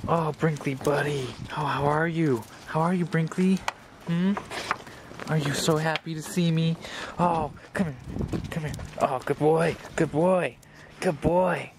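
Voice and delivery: high, tender pet voice; doting dog talk. Real, not acted. Owner greeting his dog before giving him away, high and doting.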